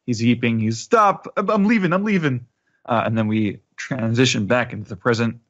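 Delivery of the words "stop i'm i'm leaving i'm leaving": put-on beaten old man. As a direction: cry out to make the beating stop. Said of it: "they're beating him up".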